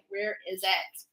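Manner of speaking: usual speaking voice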